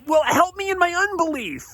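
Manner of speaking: very high, strained cry for help; acted-out frantic father wailing; panicked plea. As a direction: beg in sudden panic. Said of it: "he panics".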